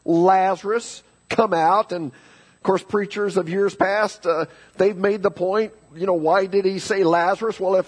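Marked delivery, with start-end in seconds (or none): 0.0-1.9 raised-voice summons, a little higher; imitating a commanding call, not a full shout; calling out loudly. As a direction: call it out to someone in a tomb. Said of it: "he called out in a loud voice".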